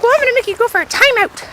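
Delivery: high, put-on mother voice; scolding mom. Cousin's mom orders a timeout, high scolding voice.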